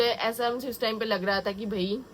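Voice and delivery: ordinary talk, nothing marked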